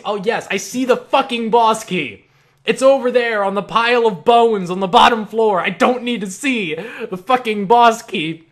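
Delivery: loud, high sarcastic outburst; exasperated rant. Acted. Player fed up with the helper's hints, loud exasperation. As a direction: snap at a needless hint, hammering the words.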